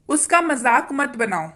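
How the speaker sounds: ordinary delivery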